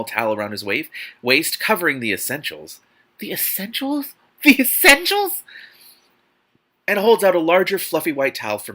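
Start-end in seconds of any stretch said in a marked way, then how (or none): 3.2-4.1 incredulous repeat. Real, not acted. 4.4-5.4 much higher, louder, slower squeal; shocked disbelief. Real, not acted. Reader squealing in disbelief at the book's wording.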